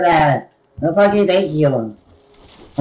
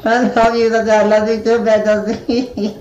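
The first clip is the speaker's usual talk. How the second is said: ordinary talk, nothing marked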